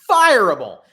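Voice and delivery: the word "Fireable." punched out loud on its own; emphatic. Real, not acted. Podcaster condemning the GM, loud and emphatic. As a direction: hit "Fireable." hard as a verdict. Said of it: "It is a fireable offense".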